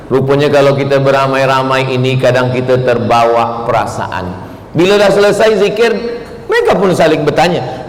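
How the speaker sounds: ordinary delivery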